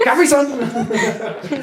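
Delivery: giggly, exaggerated slow repeat; laughing mockery. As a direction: stretch it out mockingly as you laugh. Mocks the disputed pronunciation, laughing.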